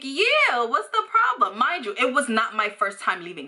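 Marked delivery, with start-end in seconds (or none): 0.0-1.6 defiant. Retold or imitated. Defiant reply, high.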